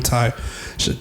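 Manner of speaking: normal talking voice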